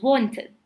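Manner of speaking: "wanted" with a clear "id" ending; two syllables in "wanted", ending in id. Shown as a demonstration. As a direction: sound the vowel before the final D in "wanted". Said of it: "you pronounce it like id".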